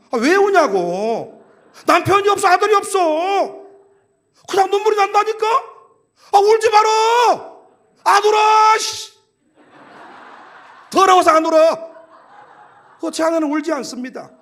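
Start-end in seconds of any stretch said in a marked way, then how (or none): 0.1-11.9 exasperation building to a high, loud shout; acted marital quarrel. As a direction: act out a bickering couple, escalating. 부부 말다툼을 점점 크게 재연. (점점 언성 높아지는 부부싸움 흉내)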